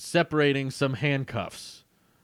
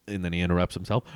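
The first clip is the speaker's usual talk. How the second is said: normal talking voice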